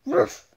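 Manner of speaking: sudden loud mock bark; one sharp loud ruff; loud dog bark. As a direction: bark like the pet wolf. Playing the wolf barking.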